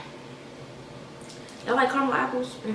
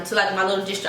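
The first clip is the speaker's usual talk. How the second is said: ordinary talk, nothing marked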